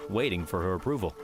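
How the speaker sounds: ordinary delivery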